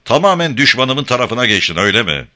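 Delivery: restrained narrated fury; angry accusing cry. A narrator's reading. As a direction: throw the question at them accusingly. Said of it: "diye haykırdı".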